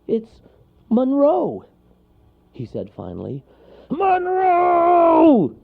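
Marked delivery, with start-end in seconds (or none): 0.1-1.6 high, halting, joyful; delighted little character; relieved delight. A narrator's reading. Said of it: "so relieved and delighted he could hardly speak". 3.9-5.6 long, high, booming holler; gruff foghorn shout; bear calling out. A narrator's reading. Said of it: "called the bear".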